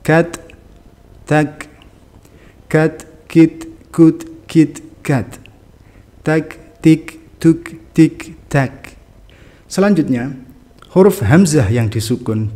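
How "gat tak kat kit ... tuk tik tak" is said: higher syllables with lightly puffed final t and k; hams. Shown as a demonstration. Teacher drills students, breathy release on final stops. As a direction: let a small breath follow each final stop. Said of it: "dibaca hams".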